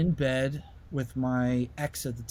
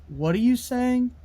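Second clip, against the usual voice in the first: eerie question; 10 semitones higher, 4 dB louder than usual. Retold or imitated. Ex voiced in dream.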